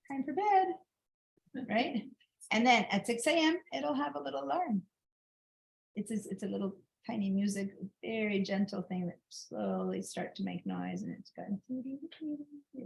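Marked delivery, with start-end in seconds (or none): 0.1-1.6 high, drawn-out phone reminder; phone alarm voice. Retold or imitated. Phone alerting its owner at bedtime, high drawn-out alarm voice. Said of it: "send you a little alarm saying". 11.7-12.8 soft, high doo-doo imitation of an alarm; very quiet alarm tune. Teacher imitating the phone's gentle morning alarm, very quiet high tune. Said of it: "very quiet".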